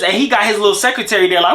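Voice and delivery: normal talking voice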